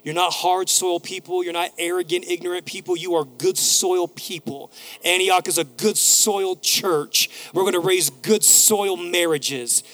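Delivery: preaching at full force. Acted. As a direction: build it up like a revival climax. Sermon climax to congregation.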